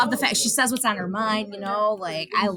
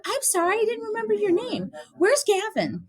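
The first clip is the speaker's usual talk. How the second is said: lightly voiced young niece, pitched higher; imitating niece; 11 semitones higher than usual. Aunt imitating her niece on the phone, a hint of a child voice. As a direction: squeak it out like a little kid. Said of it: "You go".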